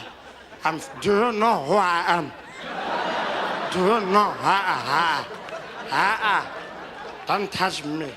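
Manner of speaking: drunken old statesman impression; halting, groaning old man, hammed-up protest; Madiba. Wasted birthday man waving off Grasa, slurred protest. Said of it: "seeing Madiba pop out".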